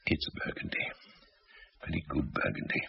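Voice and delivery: barely voiced confusion over the wine; hushed bewilderment. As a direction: murmur it in quiet disbelief.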